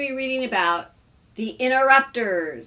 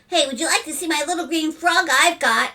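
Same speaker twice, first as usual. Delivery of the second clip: put-on high, louder child voice; eager little boy; 7 semitones higher, 6 dB louder than usual. A narrator's reading. Young son interrupts to show frog, eager child. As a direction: pipe up eagerly like a kid.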